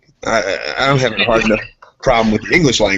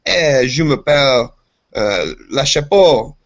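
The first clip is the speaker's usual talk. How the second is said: slow, higher, hammed-up French; showing-off French; 4 semitones higher, 4 dB louder, slower than usual. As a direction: draw out fake French to impress. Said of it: "I'll be like".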